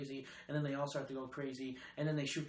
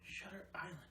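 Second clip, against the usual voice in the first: hushed echo of the title; whispering the movie title back; whispered title; 4 semitones higher, 10 dB quieter than usual. Real, not acted. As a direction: whisper Shutter Island to yourself.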